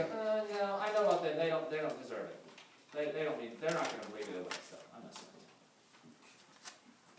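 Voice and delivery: dismissive brush-off fading to a whisper; dismissive, trailing away. Retold or imitated. Preacher imitating a church writing people off, fading to a whisper. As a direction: wave them off, then let the words die in a whisper. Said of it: "look at another and go".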